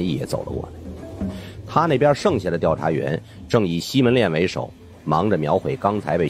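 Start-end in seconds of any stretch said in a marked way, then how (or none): none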